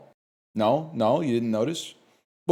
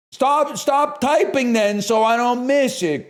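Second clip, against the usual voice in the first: whiny plea; 10 semitones higher, 3 dB louder, slower than usual. Acted. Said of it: "it's so hard to be a streamer".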